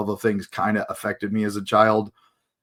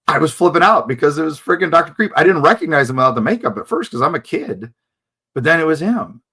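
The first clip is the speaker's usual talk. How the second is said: excited; 5 semitones higher, 4 dB louder, faster than usual. Real, not acted. Excited recalling meeting his idol.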